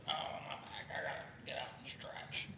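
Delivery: put-on whispery creaky old man groan. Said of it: "that's apparently my old man voice".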